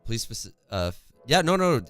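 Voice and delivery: usual speaking voice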